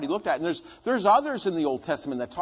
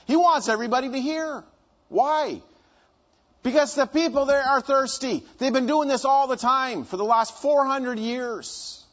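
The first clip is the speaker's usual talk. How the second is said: preaching at full force; 7 semitones higher, 4 dB louder than usual. Acted. Preacher urging his congregation, raised and higher. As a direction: preach it out to the whole room.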